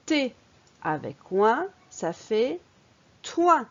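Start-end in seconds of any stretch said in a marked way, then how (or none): 3.2-3.7 "toin" pitched high and loud as one syllable; model for repeating. Shown as a demonstration. Teacher reads a syllable for the pupil to repeat, emphatic. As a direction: punch out "toin" high for the learner to copy. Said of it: "Répète après moi".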